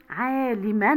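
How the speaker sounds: عالمًا ending in -an; تنوين بالفتح. Shown as a demonstration. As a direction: sound out the -an of عالمًا. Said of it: "في التنوين بالفتح". (نطق عالمًا بفتحتين في آخرها)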